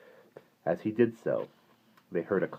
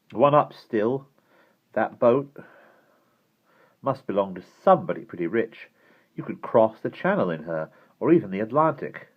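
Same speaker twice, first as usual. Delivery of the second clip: put-on a touch of boyish admiration, raised pitch; higher, louder character voice, mildly impressed; lightly voiced eager boy; 5 semitones higher, 6 dB louder than usual. A narrator's reading. Boy admiring a boat in story.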